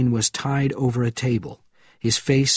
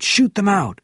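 shouting; 9 semitones higher, 4 dB louder than usual. A narrator's reading. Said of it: "he shouted".

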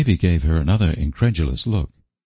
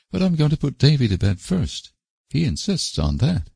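gaily; 9 semitones higher than usual. A narrator's reading. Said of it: "agreed Anne gaily".

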